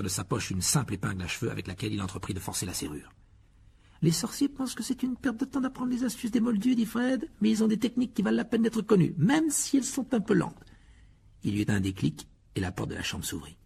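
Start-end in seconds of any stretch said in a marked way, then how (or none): 4.0-10.6 high-pitched character voice; high voice, slowing at the end; Fred's character voice. Acted. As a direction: put the twin's lines way up high. Fred's dialogue voice in story.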